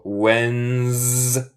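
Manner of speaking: Wednesday stretched out as WENZZ-day; silent D. Shown as a demonstration. Teacher shows the silent letter, slow drawn-out Wednesday. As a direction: drag out Wednesday slowly, no first D. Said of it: "el D es silento".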